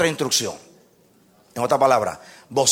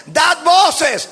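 high, raised proclamation; dar voces; 10 semitones higher, 3 dB louder than usual. Acted. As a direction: call it out as a command. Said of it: "la tercera voz que decía". (proclamado en voz alta)

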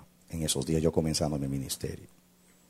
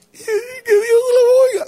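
high, loud, drawn-out sobbing cry; imitated wailing; 12 dB louder, slower than usual. Weeping mother answering the pastor's promise, imitated wail. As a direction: wail it slowly through mock tears.

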